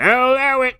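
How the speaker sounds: high, loud mock ref ruling; lax referee. Referee shrugging off the fouls, high mock ruling. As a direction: wave it through like a careless ref. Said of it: "the ref's like".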